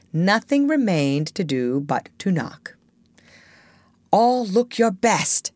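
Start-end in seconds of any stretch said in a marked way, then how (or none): 4.1-5.5 higher-pitched, mild warning. A narrator's reading. Peter readying the boys before the knock, mildly warning. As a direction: caution the group, pitched up. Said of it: "Peter warned them".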